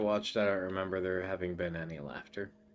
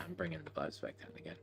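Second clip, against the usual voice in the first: whisper; 5 semitones lower, 12 dB quieter, much faster than usual. Real, not acted. Self-aware aside dropping the mood.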